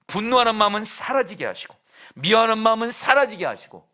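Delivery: loud, high preaching climax; climactic preaching. Acted. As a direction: build the climax with full force.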